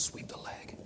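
very soft whispered order; mock-menacing whisper; sinister teacher. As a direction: hiss it low like a ruthless sensei. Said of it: "his teacher's like".

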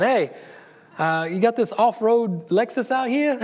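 high, slow, put-on New York voice; doing a hammed-up New York caller; New York accent. Pastor imitating Ben's prank call in a put-on New York accent. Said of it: "with a New York accent".

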